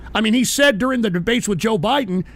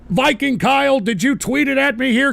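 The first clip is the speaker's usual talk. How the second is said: ordinary talk, nothing marked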